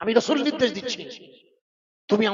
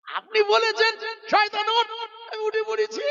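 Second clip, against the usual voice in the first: cheeky Satan; 13 semitones higher than usual. Retold or imitated. Satan cheekily answering Nuh, high and drawn. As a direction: tease the prophet as Satan.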